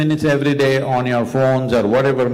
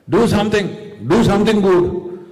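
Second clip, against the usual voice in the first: higher, lightly acted fatherly order; insistent father; 7 semitones higher, 3 dB louder than usual. Father pushing his puzzled sons to act, insistently. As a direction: press the order on the boys.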